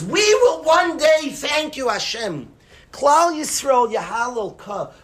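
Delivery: high, loud shout of faith; triumphant shout. Retold or imitated. David vowing to thank Hashem, ringing shout. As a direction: proclaim it to the heavens.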